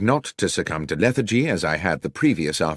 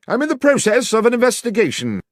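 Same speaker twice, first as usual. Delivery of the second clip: high, loud call from behind a door; calling back; 5 dB louder than usual. A narrator's reading. Holmes calls back from the basement, loud and high. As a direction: project it through the shut door.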